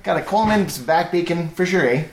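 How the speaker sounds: high, hammed-up Canadian; Canadian accent. GM riffing on Canadian clichés in a mock accent. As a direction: play the beer-loving Canadian. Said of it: "full Canadian".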